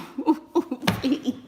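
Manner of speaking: high wheezing laugh. Real, not acted. Laughing at her Oprah joke, high cackle. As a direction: cackle at your own joke.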